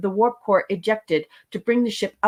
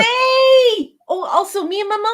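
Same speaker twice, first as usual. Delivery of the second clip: much higher, louder squeal of hello; a delighted high shout of hey; excited greeting; 14 semitones higher, 7 dB louder than usual. Real, not acted. Co-host greets Fiona with a high squeal.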